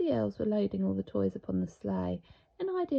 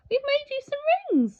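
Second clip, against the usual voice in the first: a much higher cheerful Santa; a lightly voiced eager Santa; 5 dB louder, faster than usual. A narrator's reading. Santa offering the dinosaur his gift.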